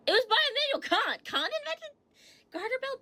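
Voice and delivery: can't believe what she's reading; amazed disbelief. Real, not acted. Streamer reading to chat that Kant invented it, amazed. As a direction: pitch jumps way up in astonished disbelief.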